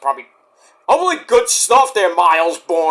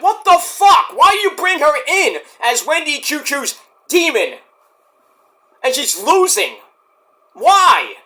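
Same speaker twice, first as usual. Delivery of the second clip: high-pitched, indignant complaining; exasperated rant; 7 semitones higher than usual. Real, not acted. Fan ranting over Rosemary's booking, exasperated. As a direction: protest in disbelief, voice pushed up high.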